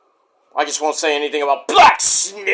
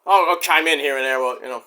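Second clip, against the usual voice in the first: ordinary talk, nothing marked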